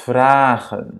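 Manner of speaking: VRAGEN with a long, open AA; long vowel. Shown as a demonstration. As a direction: draw out the first vowel of VRAGEN. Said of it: "soit prononcée de manière longue".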